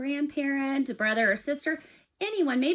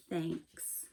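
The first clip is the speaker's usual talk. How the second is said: much lower and softer, a touched whisper-like thanks; 6 semitones lower, 11 dB quieter than usual. Acted. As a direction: let it come out quiet and moved. Bear, comforted, finally thanks.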